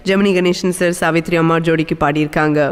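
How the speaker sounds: usual speaking voice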